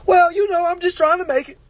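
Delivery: high, loud whiny excuse; whiny peasant. Acted. Mocking the viewers' excuse in a high loud whine. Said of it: "some peasant shit like".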